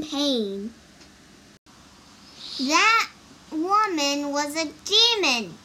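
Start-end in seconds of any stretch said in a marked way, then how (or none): 2.6-5.6 high, loud accusing cry. A narrator's reading. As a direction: cry it out in alarm. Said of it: "cried Wukong".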